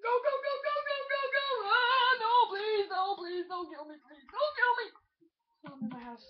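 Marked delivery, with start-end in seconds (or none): 0.0-1.5 high, loud frantic go go go; frantic urging. Real, not acted. Fleeing skeleton in game. 1.7-4.9 high, loud desperate begging; anguished denial. Real, not acted. Begging while being killed.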